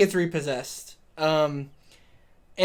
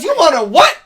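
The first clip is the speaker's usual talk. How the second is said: loud, high incredulous outburst; mock outrage, pitched up and loud; shocked; 9 semitones higher, 10 dB louder than usual. Host acting the woman hearing the driver's demand, shocked. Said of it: "And she goes".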